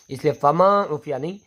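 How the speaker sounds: ordinary delivery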